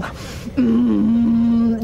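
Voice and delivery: long, loud droning hum; sustained engine-like yoga breath; calming drone on one note. Acted. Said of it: "it's my Ujjayi breathing".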